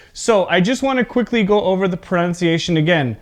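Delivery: ordinary talk, nothing marked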